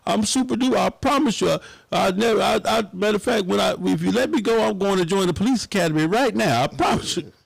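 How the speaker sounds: high, pleading mock apology in court; hammed-up begging for mercy from the judge. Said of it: "I was in there boo-hooing, begging".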